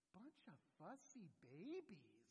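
slow, drawn-out swooping mockery; exaggerated sing-song jibe; mocking taunt. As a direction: tease whiners with long, swooping vowels. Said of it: "term that comes just bubbling out my lips".

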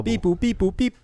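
mock robot beeping. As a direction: beep boop computer noise. Robot noises while looking up list.

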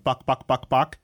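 a hint of chicken clucking; lightly clucking chicken. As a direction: barely mimic the cluck.

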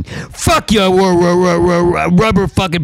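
high, strained angry burst; angry outburst. Real, not acted. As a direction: spit it out in fury.

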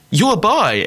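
mock accusation, pitched high; accusing. Girl confronting speaker's friend in public, accusing. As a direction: imitate a pushy girl confronting someone.

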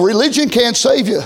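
normal talking voice

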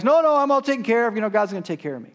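loud, high refusal to rescuers; acted holler across the water; calling back. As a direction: shout back that you're fine, easing off. Flood victim calls back refusing.

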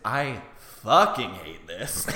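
loud, drawn-out mock loathing; exasperated outburst. Viewer watching a nightmare scene, loud slow exasperation. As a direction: lean slowly and hard on each word. Retelling own reaction while watching.

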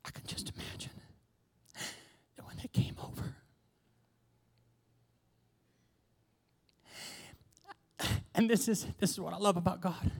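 quiet, tender wonder; soft reflective preaching. Acted. Preacher musing softly to the congregation. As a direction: marvel gently at the small cloud.

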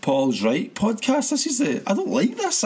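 high-pitched exasperated protest; exasperated outburst. Real, not acted. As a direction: throw your voice way up in disbelief. Pundit exasperated at being right.

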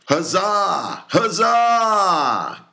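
high, loud cheer; cheering seamen. A narrator's reading. Seamen cheer as the gold is nailed up, loud and high. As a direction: throw up a sailors' huzzah. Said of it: "cried the seamen".

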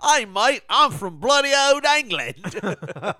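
loud, high mock-British, drawn out at the end; hammed-up British accent. Redoing the British person for co-host, exaggerated British accent. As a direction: ham up a broad English accent. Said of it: "if some British person was like".

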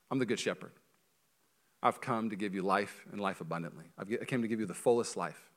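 soft, low and tender; gentle Jesus. Acted. Jesus offering life to his people, gentle and hushed. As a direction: speak it gently and quietly, as a shepherd.

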